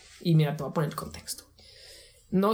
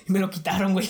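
ordinary talk, nothing marked

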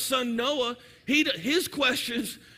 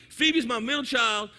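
normal talking voice

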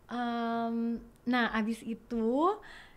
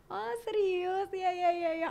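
giddy agreement; 8 semitones higher, slower than usual. Retold or imitated. Acts out accepting the dinner invitation.

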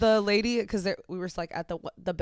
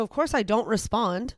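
usual speaking voice

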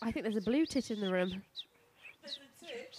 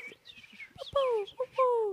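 high chirped "whoop" bird calls; 12 semitones higher, much slower than usual. Shown as a demonstration. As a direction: mimic a bobbing wagtail. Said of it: "That was a good".

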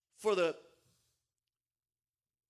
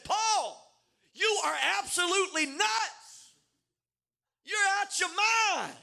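mocking outburst; 9 semitones higher than usual. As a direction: throw it at him like he's lost it. Festus mocks Paul as crazy.

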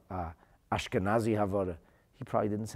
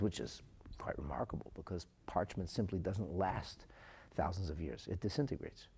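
hushed aside; 3 semitones lower, 9 dB quieter than usual. Real, not acted. Aside about parchment decaying.

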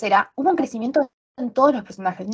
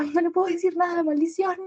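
mock frustration; 8 semitones higher, 4 dB quieter than usual. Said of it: "yo estaba como".

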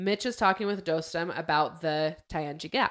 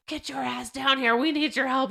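raised, higher and sharper demand; mock angry order; 8 semitones higher, 3 dB louder than usual. Soldier yelling at a pilot over the radio, mock angry. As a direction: bark at the pilot to come down. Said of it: "And he's like".

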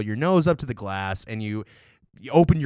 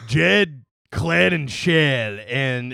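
slow put-on Southern drawl on each name; Southern accent; 3 dB louder, much slower than usual. Farm boy naming his brothers to his co-host, Southern accent. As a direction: list them in a hammed-up Southern farm accent. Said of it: "start doing a Southern accent".

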